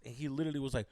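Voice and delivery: normal talking voice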